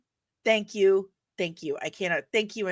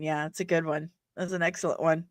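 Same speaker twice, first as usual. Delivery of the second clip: normal talking voice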